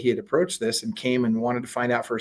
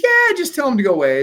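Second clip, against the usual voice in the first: breezy, raised-pitch insistence, falling at the end; dismissive attorney; 12 semitones higher, 6 dB louder than usual. Retold or imitated. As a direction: wave the worry away as the attorney. Attorney brushes off the worry.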